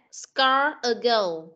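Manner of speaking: slow, careful model reading. Shown as a demonstration. Teacher modelling the phrase slowly for the pupil. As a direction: draw out "Scar a girl" slowly. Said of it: "teacher models the phrase".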